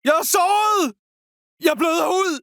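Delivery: high-pitched call for aid; acted injured soldier's shout; wounded cry. Wounded soldier calls for help.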